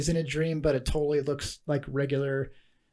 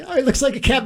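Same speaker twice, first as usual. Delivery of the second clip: higher, louder retold character line; imitated movie character; 9 semitones higher, 6 dB louder than usual. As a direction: play the joke's setup, pitched up. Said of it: "Then he explains it".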